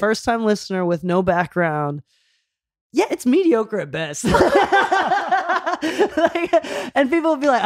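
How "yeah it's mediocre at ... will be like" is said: laughing. Real, not acted. Laughing at own hot take.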